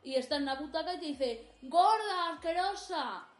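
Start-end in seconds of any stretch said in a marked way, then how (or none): 1.6-3.3 shouted insult from the seats; a jeering heckler, louder; mock heckler shout. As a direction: yell it at the stage like a rude heckler.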